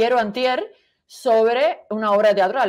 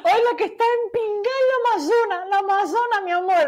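burla aguda; 10 semitones higher than usual. Acted. Burla sobre la amazona.

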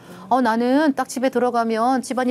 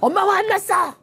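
mock angry yell; 10 semitones higher than usual. Mother yelling at her child in denial, mock yell. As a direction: shout as a mother who denies being angry. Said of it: "막 이게 아니라".

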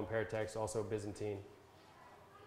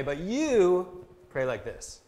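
"you" drawn out, high and loud; stress on you; 7 semitones higher, 7 dB louder, much slower than usual. Shown as a demonstration. Contrasting the Gentiles with the listeners, stress on you. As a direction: punch a long, raised "you", then settle on the rest. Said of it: "it's an emphatic pronoun".

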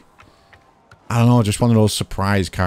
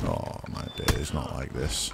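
whispering; 7 semitones lower, 11 dB quieter, slower than usual. Real, not acted. Losing the round.

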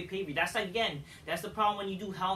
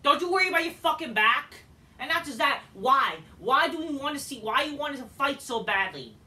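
frustrated rant; 5 semitones higher, 6 dB louder than usual. Real, not acted.

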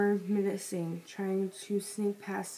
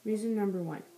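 ordinary delivery

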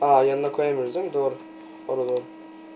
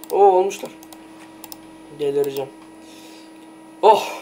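usual speaking voice

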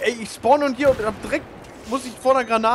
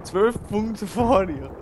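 slow gloating; 2 semitones higher, much slower than usual. Real, not acted.

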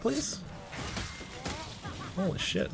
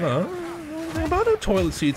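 fake polite; 9 semitones higher than usual. Senior playing dumb to the angry trainer, mock polite. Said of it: "fake polite bullshit that kids do".

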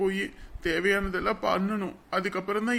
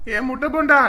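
loud, high mock insult; teasing call; 4 semitones higher, 8 dB louder than usual. Brother teases his sister with a nickname, loud and high.

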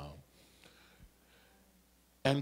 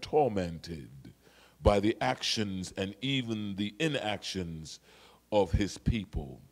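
tender quiet preaching; 7 semitones lower, 5 dB quieter than usual. Acted. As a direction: draw the church in close, gently. Preacher drops to intimate close.

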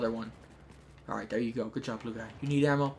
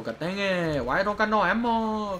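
higher, drawn-out whine; whiny complaint; 6 semitones higher, slower than usual. Real, not acted. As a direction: whine it in exasperation. Complains at running out of ammo.

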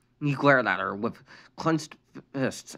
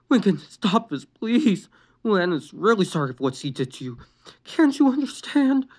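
pleading; 8 semitones higher than usual. A narrator's reading. Mother pleading with her son, desperate. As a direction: plead gently but urgently. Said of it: "Rita pleaded".

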